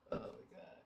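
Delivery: whispered, worn-out sigh; tired whisper. Real, not acted. Streamer tells chat she is exhausted, in a tired whisper. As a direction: breathe it out exhausted. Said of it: "I'm so tired".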